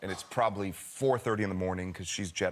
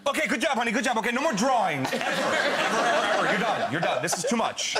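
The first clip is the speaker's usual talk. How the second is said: high, loud mock outburst; hammed-up parent at the end of their tether; exasperated dad; 10 semitones higher, 5 dB louder than usual. Woken dad bans daughter from drawing, exasperated outburst.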